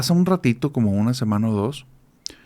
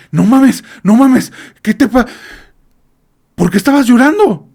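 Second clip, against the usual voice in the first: much higher and louder shocked exclamation; alarmed outburst; 13 semitones higher, 9 dB louder than usual. Retold or imitated. Husband bursting in on his wife, shocked and loud. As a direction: gasp it out, rattled and loud.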